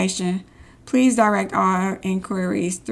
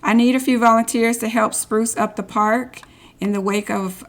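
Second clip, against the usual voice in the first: normal talking voice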